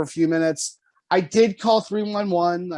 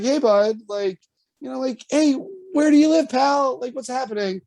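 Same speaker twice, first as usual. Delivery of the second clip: put-on high, coaxing voice for a toddler; high sing-song kid voice; 8 semitones higher than usual. To a toddler in a diaper on the sidewalk, high coaxing voice. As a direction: coax a lost little kid sweetly, pitched up.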